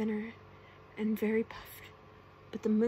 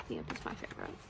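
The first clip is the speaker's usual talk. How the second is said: usual speaking voice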